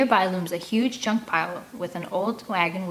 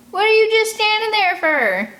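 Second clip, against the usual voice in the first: much higher and louder scolding; bossy woman; 14 semitones higher, 8 dB louder than usual. Acted. Big woman yelling at her sons, shrill scolding. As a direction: bark at the lazy boys.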